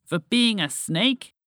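high, slow, rising question; incredulous. A narrator's reading. Rescued frog answering the snake, incredulous. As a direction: stretch it out in disbelief. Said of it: "Walter asked incredulously".

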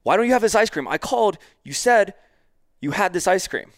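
high, loud indignant complaint; upset customer. Retold or imitated. Customer confronting store worker over missing ice cream, upset. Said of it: "all upset. He's like".

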